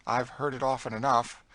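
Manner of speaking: dryly knowing. A narrator's reading. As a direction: answer dryly from long experience. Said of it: "said Cassie dryly".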